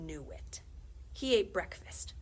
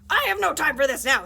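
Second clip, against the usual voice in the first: angry; 7 semitones higher, 16 dB louder than usual. Acted. Principal snaps at salesman.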